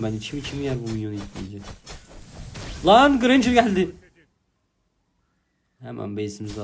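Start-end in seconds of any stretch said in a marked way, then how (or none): 2.9-3.9 high, loud, slow startled yell; sudden alarm as the enemy arrives; shocked loud warning cry; alarmed shout. Real, not acted.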